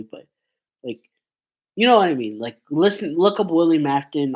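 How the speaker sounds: usual speaking voice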